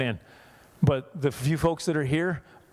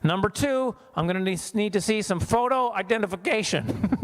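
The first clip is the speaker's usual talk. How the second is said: mock bank teller; 6 semitones higher than usual. Comic bank teller demanding ID.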